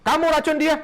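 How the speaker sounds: high and loud accusing question; stern demand. Acted. The Prophet confronts the companion, loud and stern. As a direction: demand an answer sharply.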